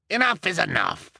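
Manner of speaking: high stern rebuke; impatient. Acted. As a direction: cut him off firmly. Young elder cuts in.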